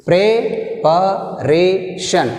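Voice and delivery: Preparation, very slow, stress on ra; stress demo. Shown as a demonstration. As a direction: drag out Preparation, land on ra. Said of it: "shan one, re two". (రా మీద ఒత్తిడి)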